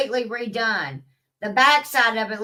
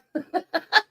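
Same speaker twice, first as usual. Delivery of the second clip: laughing; 8 semitones higher than usual. Real, not acted. Laughing after her own quote.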